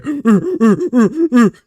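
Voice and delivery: loud, high ape grunting; angry monkey grunts. Acted. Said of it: "The monkey goes ape shit and says".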